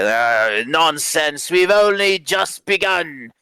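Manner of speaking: high, slow, boisterous drawl; drunken, stretched-out bluster; dismissive drunk. Acted. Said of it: "waves away".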